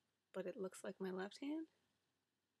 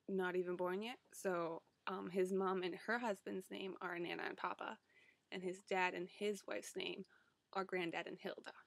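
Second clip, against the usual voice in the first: normal talking voice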